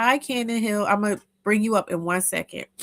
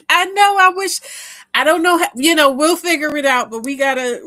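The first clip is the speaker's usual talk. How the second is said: excited; 9 semitones higher, 9 dB louder than usual. Real, not acted. Excited plea to reunite.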